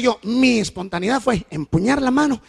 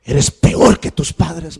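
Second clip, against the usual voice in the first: daughter's loud rebuke; 3 semitones higher, 7 dB louder, slower than usual. Retold or imitated. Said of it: "Y me dijo".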